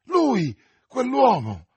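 high, loud insistence; agitated. Acted. Young man to the friends holding him, agitated. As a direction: hurl it out, wrought up.

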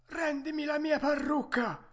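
vocetta acutissima del falegname che protesta; grido. A narrator's reading. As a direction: pretendi gridando in tono molto alto. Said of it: "gridò Mastrantonio". (grido acuto e indignato)

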